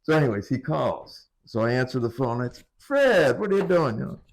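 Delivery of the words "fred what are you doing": higher, louder alarmed call. Retold or imitated. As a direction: call out his name in alarm. Caller shouting at Fred.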